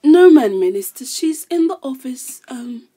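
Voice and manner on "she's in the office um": dropping to a whisper mid-line; hushed secret. Acted. Secretary whispers to the minister about her boss. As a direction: lowers her voice to tell the secret.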